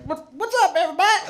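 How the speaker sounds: put-on high, loud drunken hello to the room; cocky drunk shouting hi to everyone; drunk party greeting. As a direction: play the life of the party, way up high. Drunk him greeting the bar.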